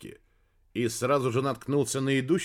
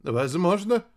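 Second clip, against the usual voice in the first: raised, wavering guess; faltering babble; 6 semitones higher, 4 dB louder, slower than usual. A narrator's reading. Science chief evading the truth, faltering. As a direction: answer haltingly, pitched up. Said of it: "пролепетал начальник научной службы".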